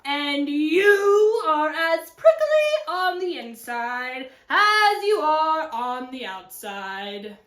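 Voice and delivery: slow, singsong coyote scolding; offended coyote. Acted. Coyote telling off the cactus, slow and offended. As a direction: stretch the words loudly, then settle.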